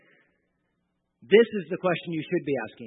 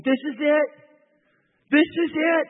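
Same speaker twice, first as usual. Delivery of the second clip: much higher and louder, thrilled; excited disciples; 11 semitones higher, 8 dB louder than usual. Retold or imitated. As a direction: voice the disciples' eager hope. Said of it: "they're probably thinking".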